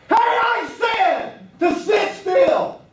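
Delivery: very loud, high, drawn-out yell; sudden scream. Shown as a demonstration. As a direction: startle the room with a full-force shout. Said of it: "when I screamed like that".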